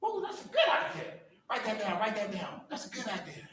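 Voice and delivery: lightly imitated, laughing encouragement; encouraging colleague. Speaker imitating a colleague praising ideas, laughing mock praise. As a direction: mimic her cheerful praise, chuckling. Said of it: "She makes you feel good in the meeting".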